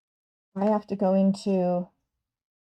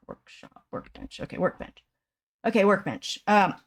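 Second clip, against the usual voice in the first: ordinary talk, nothing marked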